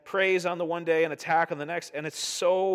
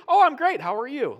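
much higher and louder, brightly faking fine; fake cheerful; 10 semitones higher, 9 dB louder than usual. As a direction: paper over the pain with a forced grin. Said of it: "you're like".

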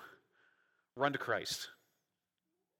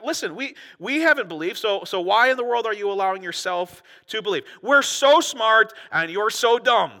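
high, emphatic talking-down, slowing and louder at the end; condescending mock lecture; 5 semitones higher, 6 dB louder than usual. Pharisees scolding officers for believing, condescending. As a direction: talk down to them as the clever ones. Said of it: "this condescending attitude".

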